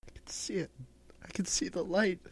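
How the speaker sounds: faint, breathy last words; dying whisper. Pretend. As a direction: whisper like life is fading. [name] fading, sees the light.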